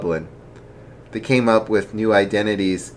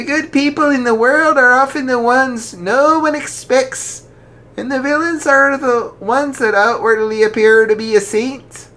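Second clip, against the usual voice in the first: jolly archbishop; 5 dB louder than usual. Acted. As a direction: ask gently but heartily. Archbishop voiced in story.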